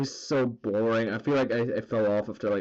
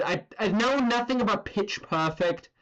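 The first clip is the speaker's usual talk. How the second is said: ordinary delivery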